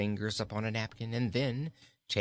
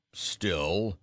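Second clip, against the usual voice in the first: low growling goat voice; 4 semitones lower, 4 dB louder than usual. A narrator's reading. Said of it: "growled Bilbil".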